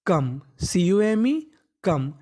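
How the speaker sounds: letters drawn out one by one; teacher spelling a word for pupils; slow spelling out. Shown as a demonstration. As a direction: spell each chunk very slowly. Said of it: "spelling out come".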